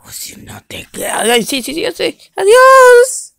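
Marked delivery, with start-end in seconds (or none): 1.3-3.3 high, loud, hammed-up sobbing goodbye; mock crying dog. Eliminated dog wails goodbye, high and mock crying. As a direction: wail as you leave.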